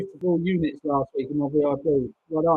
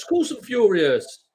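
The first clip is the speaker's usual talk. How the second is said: slow, higher and a little louder, the anger held; seething; 4 semitones higher, 4 dB louder, slower than usual. Real, not acted. Tipster insisting to a viewer that he's angry, slow seething. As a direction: lean on "course", slow and pressing.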